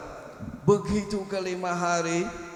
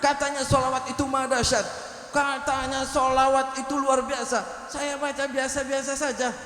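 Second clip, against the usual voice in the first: raised, aggrieved complaining voice; complaining doubter; 6 semitones higher than usual. Retold or imitated. Doubting man complaining to the healer, high aggrieved voice. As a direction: whine it up high like a man who feels cheated.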